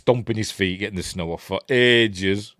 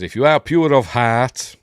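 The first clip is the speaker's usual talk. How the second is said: louder, raised, slow declamation; mock film quote; 4 semitones higher, 9 dB louder than usual. Quoting the film's test, mock grand declaiming. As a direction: proclaim it slowly and grandly.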